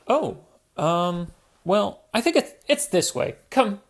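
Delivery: put-on high, cheerful little girl piglet; Peppa Pig voice. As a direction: invite Daddy into the mud, high and bright.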